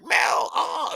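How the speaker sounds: high-pitched, happy cry of recognition; super happy greeting. Retold or imitated. Fan running up to the DJ at the front, super happy. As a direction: re-enact spotting a friend, delighted and high. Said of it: "I'm, like, just super happy".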